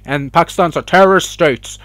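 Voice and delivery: hammed-up pundit voice, building to loud blah blah; mock the anchor, then drown him out; sarcastic imitation of an Indian news host. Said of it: "He's saying".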